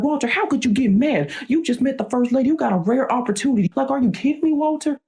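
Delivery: high-pitched scolding, no added volume; mock yell of outraged parents; imitated angry shouting. Parents scolding Walter for getting mad, high-pitched mock shouting. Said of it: "they're, like, shouting at me".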